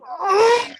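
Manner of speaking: loud, high cry of frustration; frustrated groan. Shown as a demonstration. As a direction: let out a big exasperated "oh". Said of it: "Go and just go".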